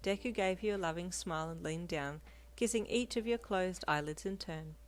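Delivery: usual speaking voice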